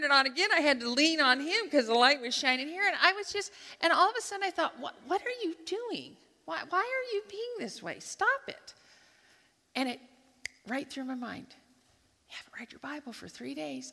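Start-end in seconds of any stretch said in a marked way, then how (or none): 4.8-8.7 high, exasperated scolding of herself; self-scolding. Retold or imitated. To herself on the plane, a high exasperated scolding. 12.3-13.9 hushed whisper of conscience; whispered inner voice. Retold or imitated. The voice in her mind, a soft whisper.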